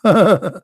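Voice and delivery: real, high chuckle; laugh. Real, not acted. Speaker amused by his own punchline, chuckling. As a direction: burst into a short laugh.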